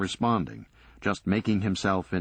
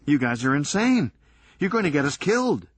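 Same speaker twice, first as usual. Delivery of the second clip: high, loud and rushed, near hysteria; panicked outburst; 8 semitones higher, 5 dB louder than usual. Acted. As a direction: blurt it out in rising panic. Doug panics at his friends.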